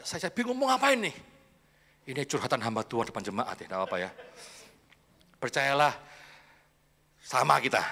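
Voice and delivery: usual speaking voice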